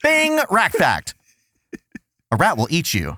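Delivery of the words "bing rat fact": high, slow jingle voice; game show jingle. Pretend. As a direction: sing it like a quiz show chime. Jingle-style rat fact bit.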